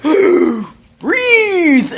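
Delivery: a big panicked puppet yell; Sesame Street character. Retold or imitated. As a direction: gasp then a high, loud cry of breathe. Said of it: "Breathe, Think, and Do, and it's like".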